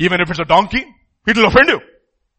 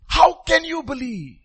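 slow pleading question; 7 semitones higher, slower than usual. Real, not acted. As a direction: stretch "How can you believe?" slowly, higher. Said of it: "repeated question, slow".

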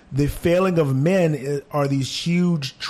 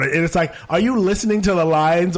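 ordinary talk, nothing marked

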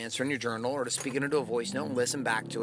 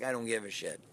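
low, soft brush-off; dismissive energy; 4 semitones lower, 6 dB quieter than usual. Shown as a demonstration. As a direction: shrug it off under the voice, as if indifferent. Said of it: "but your energy is like".

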